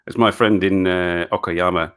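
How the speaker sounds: usual speaking voice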